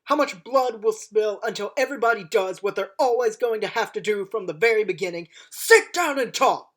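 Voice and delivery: loud, impassioned speech building to a shout; booming rousing general speech. Acted. Doctor's rising speech about war.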